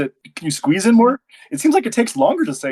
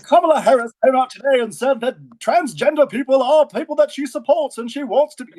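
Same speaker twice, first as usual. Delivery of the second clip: put-on high, rapid old-timey announcer read; forties newsreel voice; 8 semitones higher than usual. Shown as a demonstration. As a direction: read today's news like a 1940s newsreel. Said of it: "people talking like this".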